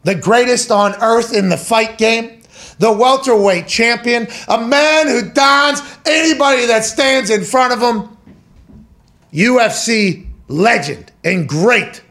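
slow, stretched fight-announcer build-up; announcer introduction. Acted. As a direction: build up the champion word by word. Announces guest in ring-announcer style.

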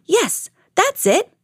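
high, drawn-out cry of realization; a bear's delighted realization; excited cry. A narrator's reading. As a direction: exclaim it high, like a storybook bear. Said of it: "cried Bear".

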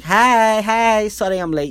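loud, high-pitched call of hello; eager greeting. Retold or imitated. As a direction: greet your host with over-eager, drawn-out heys. Retold greeting to his host.

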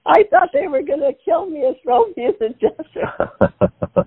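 talking through laughter; laughing. Real, not acted. Mother tells the host her fear while laughing. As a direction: laugh as you admit the fear.

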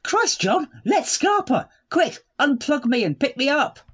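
urgent comic fleeing plea; mock panic. Panicked escape in sketch.

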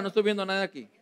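normal talking voice

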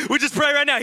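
loud, high mock European-accented prayer; hammed-up European accent, full of confidence; bold European accent. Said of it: "he's, like, bold, and it's all in a European accent".

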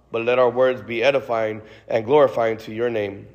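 normal talking voice

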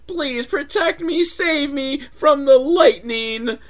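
very high, slow pleading damsel voice; hammed-up frightened begging; mock scared plea. Fox begging the wolf for protection, mock terrified and slow. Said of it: "she's scared".

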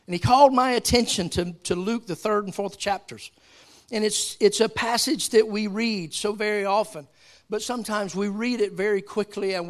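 loud, high preaching; full-force preaching. Real, not acted. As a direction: preach it out to the congregation.

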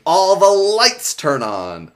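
loud, high, slow announcement; dramatic reveal. Real, not acted. Telling how suddenly the studio lights up, dramatic reveal. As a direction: stretch it out big for the reveal.

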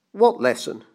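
barking. A narrator's reading. Banker standing over the clockmaker, barking. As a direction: bark the question with mild force. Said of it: "the banker barked".

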